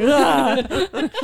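loud, high mock-crying groan; frustrated crying groan. Retelling how she cries when mad, frustrated mock-crying groan. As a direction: groan as if too mad and tearful to talk. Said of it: "I'm crying and I'm like".

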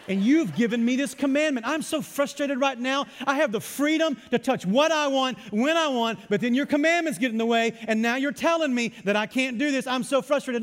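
loud, high-pitched frustrated tirade, hammed-up; mock rage. Preacher acts out resenting a high-voltage warning, mock rage. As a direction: rant like someone absurdly outraged at a rule. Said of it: "does it bring about rage in your heart?".

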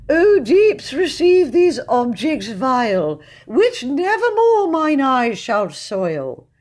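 loud, slow, grandiose verse; pompous amateur poet in full flow; high-pitched recitation. A narrator's reading. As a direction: declaim the ode in a high, drawn-out voice. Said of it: "began in his high-pitched voice".

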